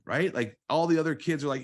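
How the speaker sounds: normal talking voice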